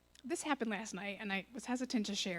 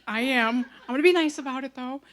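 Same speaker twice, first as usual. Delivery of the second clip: loud retold comeback; higher and much louder, standing firm; emphatic insistence; 3 semitones higher, 10 dB louder than usual. Answering a warning about returning the pop, emphatic insistence.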